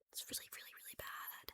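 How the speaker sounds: whispered confession. Real, not acted. Admitting to viewers she can't let go, a whisper. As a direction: breathe it out in a whisper.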